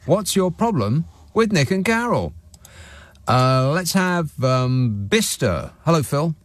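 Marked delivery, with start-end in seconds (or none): 0.1-2.3 higher, brighter ad tagline; chipper 1950s ad. Acted. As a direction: sell the title with a flourish. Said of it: "Ask for it by name".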